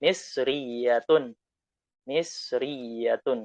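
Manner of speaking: "Misriyatun" drawn out very slowly; slow model word. Shown as a demonstration. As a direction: drill "Misriyatun" with ta marbuta. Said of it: "ditambahkan ta marbutoh".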